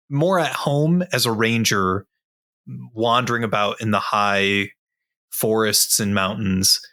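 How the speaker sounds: ordinary delivery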